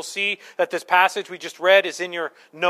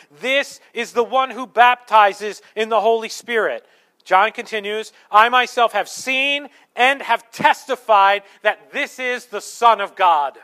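louder, weighty reading of the Baptist's words; scripture reading; 3 semitones higher, 5 dB louder than usual. Acted. Preacher reading John the Baptist's testimony, weighty and loud. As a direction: proclaim the verse with conviction. Reads scripture quote emphatically.

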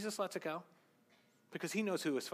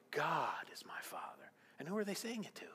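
soft voiceless whisper; whispered aside; 7 dB quieter than usual. Acted. Preacher whispers an aside to the congregation. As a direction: lean in and whisper it.

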